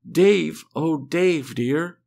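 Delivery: a high, loud cry of relief; cried out. A narrator's reading. Helen seeing Dave wake up, crying out loudly. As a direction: call his name out loud and high. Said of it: "Someone cried out".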